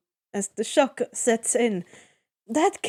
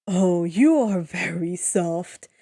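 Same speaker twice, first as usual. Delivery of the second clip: cooing; 5 semitones lower, slower than usual. A narrator's reading. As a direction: coo it gently, like petting a lamb. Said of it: "he coos".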